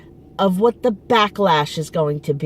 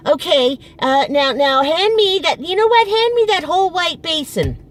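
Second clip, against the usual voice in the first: put-on higher, fussy old mother calling out; mother's voice; 7 semitones higher than usual. Daughter mimicking her mother barking chores from indoors, higher. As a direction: imitate a demanding mother changing her mind.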